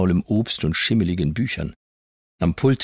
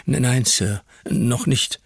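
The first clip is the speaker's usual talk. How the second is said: frightened stammer, higher; boy stammers after his ear is pinched; 7 semitones higher, slower than usual. A narrator's reading. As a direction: stutter it out in pain and fear. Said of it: "stotterte ich".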